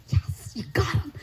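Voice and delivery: slow, breathy whisper of triumph; secret cheer. Retold or imitated. Daughter silently cheers as her dad starts to believe, whispering. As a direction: whisper it to yourself, delighted.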